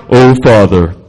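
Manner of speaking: deep, booming pompous prayer voice; hammed-up solemn invocation, low and loud; mock fancy praying. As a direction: intone it like an over-serious adult praying. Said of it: "we think we gotta be all fancy".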